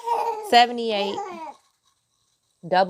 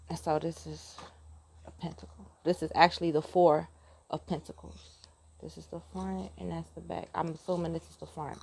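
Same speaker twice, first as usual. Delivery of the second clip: much softer, half-whispered; soft near-whisper; 3 semitones lower, 9 dB quieter than usual. Real, not acted. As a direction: drop to a hush.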